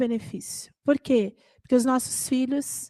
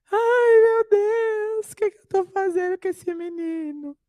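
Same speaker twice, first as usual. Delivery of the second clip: distressed cry; 8 semitones higher than usual. Retold or imitated. She starts crying.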